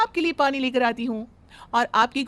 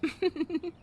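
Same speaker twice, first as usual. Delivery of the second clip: short high dismissive giggle; mocking laugh; 5 semitones higher, 7 dB quieter than usual. Bride laughing at mother-in-law's advice, mocking giggle. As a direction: laugh off her words.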